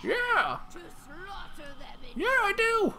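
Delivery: squeaky high bear character; a silly high bear announces itself. Pretend. Streamer voicing his bear, squeaky and high.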